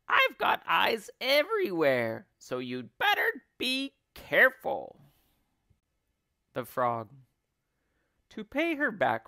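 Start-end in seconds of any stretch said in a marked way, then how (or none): 0.1-5.0 high, loud cackling crone; Missus Twit. A narrator's reading. Wife warns husband she has eyes everywhere, cackling. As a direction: crow a warning in a high cackly voice. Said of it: "crackled Missus Twit".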